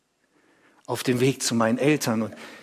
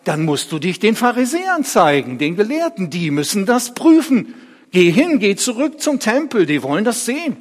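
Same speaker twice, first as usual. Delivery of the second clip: raised, urgent command; fordernd; 8 semitones higher, 6 dB louder than usual. Acted. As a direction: order him off to be examined. Nachbarn schicken ihn fordernd weg. (laut und drängend gefordert)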